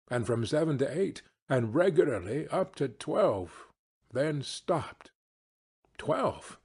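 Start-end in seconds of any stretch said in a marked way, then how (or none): 6.0-6.6 startled exclamation with a sharp falling pitch; a short, falling cry of surprise; astonished exclamation. A narrator's reading. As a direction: blurt it in astonished disbelief. Scrooge astonished by the striking clock.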